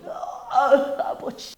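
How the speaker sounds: crying out in agony, begging father Abraham; desperate rich man. Acted. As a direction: beg for a drop of water as if burning. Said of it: "이 부자는 어떻게".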